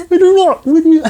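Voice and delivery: high, loud frog squeak; heckled comic answering as Kermit. Retold or imitated. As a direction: deny it while squeaking like Kermit. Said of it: "Why do you sound like Kermit".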